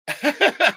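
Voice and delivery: high, loud cackle; a quick high-pitched cackle; burst of laughter. Real, not acted. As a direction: let out a sharp laugh. Bursts out laughing mid-rant.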